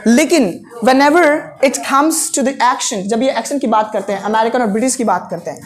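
normal talking voice

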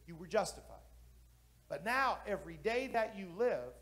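normal talking voice

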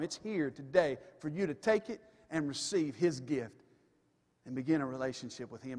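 ordinary talk

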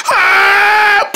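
long, high attacking yell; battle scream. Acted. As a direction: hold a high scream while charging. Battle cry before a strike.

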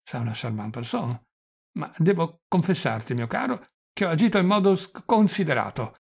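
stammering, doddering confession; flustered old prince. Acted. Old prince confessing a proposal to his nephew, flustered and stammering. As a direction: draw words out, stumble on consonants.

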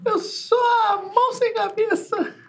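very high, thin creature voice; high creepy hand voice. Acted. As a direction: squeak it like a headless hand. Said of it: "Essa mãozinha tá tão esquisita".

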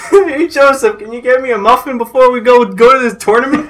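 high, hammed-up Boston accent. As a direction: pester a pal in mock Bostonian. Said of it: "he has a Boston accent".

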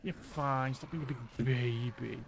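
usual speaking voice

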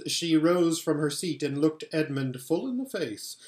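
plain reading voice

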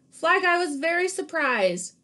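lively picture-book read-aloud; animated storybook reading; reading to children. Acted. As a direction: read it like story time for kids.

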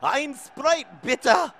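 Ein Sprite bitte very high, loud and slow with a rise on bitte; eager tourist shouting German; overexcited German. Retold or imitated. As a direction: proudly belt out the German order. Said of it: "I was so excited about knowing the word Ein and bitte".

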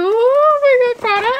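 loud, high squeal of gratitude; overjoyed. Real, not acted. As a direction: wail it high, overcome with delight. Overwhelmed thanks to the brand.

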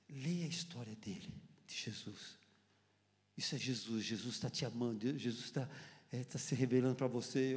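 low, soft counsel; gentle ministering. Retold or imitated. Retelling a believer handing him a Bible, gentle ministering. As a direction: speak low, softly reassuring a newcomer.

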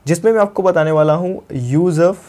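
ordinary delivery

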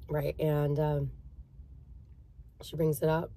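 ordinary talk, nothing marked

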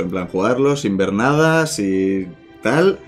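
usual speaking voice

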